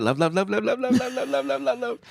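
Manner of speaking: mock high machine-gun repetition; strung-together repetition. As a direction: rattle the word off in a climbing chain. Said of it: "are you even aware that you do that?".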